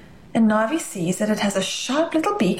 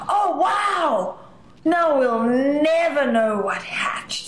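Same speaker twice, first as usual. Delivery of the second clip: excited; 4 semitones higher, slower than usual. Acted.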